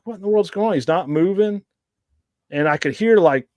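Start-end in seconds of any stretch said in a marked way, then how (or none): 0.0-1.6 higher-pitched, puzzled retelling; retold bafflement. Tracker recalls the lead going slack, retold bafflement. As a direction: retell your own bewilderment in a raised pitch.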